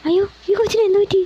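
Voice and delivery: high, fast alarmed cry. A narrator's reading. Mother sees the girl faint, alarmed and fast. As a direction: gasp it out in sudden worry.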